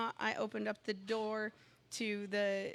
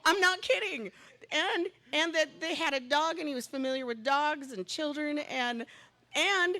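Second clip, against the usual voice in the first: much higher and louder, bursting with excitement; excited insistence; 7 semitones higher, 9 dB louder than usual. Real, not acted. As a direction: insist it's true, thrilled. Insisting the coincidence is true.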